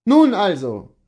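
loud, high, decisive exclamation; calling out. Acted. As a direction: exclaim it like a man who has won the point. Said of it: "rief Kohlhaas".

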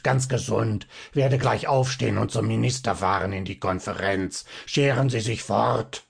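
lightly voiced angry little man, scolding; understated raging shout; furious gnome. A narrator's reading. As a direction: snap at the doctor in a put-on gnome voice. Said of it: "schrie Zinnober".

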